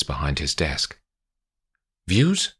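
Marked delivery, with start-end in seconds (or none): 2.1-2.5 short, higher-pitched curt question. A narrator's reading. Paddy asks the lawyer her opinion of the letter, curt. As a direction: put it briskly as a one-word demand. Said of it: "he says curtly".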